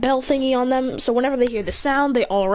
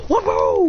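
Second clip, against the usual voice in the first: high acted whoa; startled yelp; 8 semitones higher, 4 dB louder than usual. Villain kicked by the bear, startled yelp. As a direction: yelp as if knocked flying.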